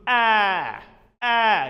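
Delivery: long loud open "aah" like a sheep; teacher's bleating "ah"; sheep bleat vowel. Shown as a demonstration. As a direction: demonstrate a sheep's bleat, drawn out. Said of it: "it is bleating of sheep".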